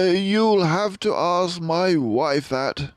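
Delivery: a worried father mouse, held together; strained father. A narrator's reading. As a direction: hold yourself together to explain. Father defers.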